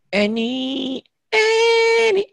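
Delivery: high sing-song call of a name; teacher showing different ways to call a name. Shown as a demonstration. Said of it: "lagi kesel mungkin lain manggilnya".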